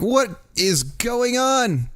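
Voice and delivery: drawn-out confused what; bewildered. Retold or imitated. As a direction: stretch it out in mock disbelief. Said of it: "and you're just like".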